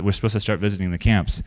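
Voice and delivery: normal talking voice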